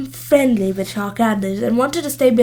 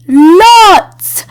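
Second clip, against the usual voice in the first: much louder, higher shout; calling up; 8 semitones higher, 12 dB louder than usual. A narrator's reading. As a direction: yell it up the tree. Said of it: "came Sharkadder's voice from below".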